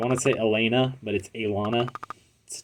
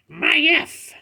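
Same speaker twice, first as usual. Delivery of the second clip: pet voice. Acted. Voicing pet character.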